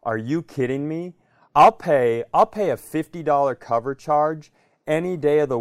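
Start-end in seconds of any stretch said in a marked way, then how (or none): none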